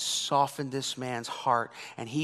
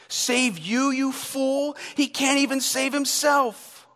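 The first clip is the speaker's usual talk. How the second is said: higher, scornful taunt; mocking jeer; 9 semitones higher, 3 dB louder than usual. Preacher voicing the crowd's mild mocking jeer. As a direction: sneer at the dying thief. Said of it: "probably mocked too".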